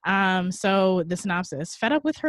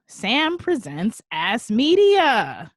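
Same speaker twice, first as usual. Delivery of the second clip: slow, loud, high showman announcement; segment announcement; 5 semitones higher, 9 dB louder, much slower than usual. Acted. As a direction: draw out the title like a show intro.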